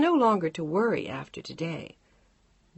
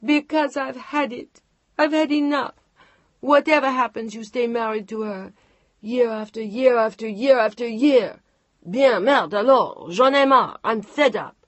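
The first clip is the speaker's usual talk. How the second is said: exasperated outburst; 6 semitones higher, 8 dB louder than usual. Acted. Chantal exploding at Mark.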